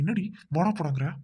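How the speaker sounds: usual speaking voice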